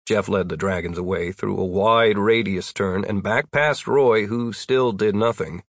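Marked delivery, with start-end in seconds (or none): none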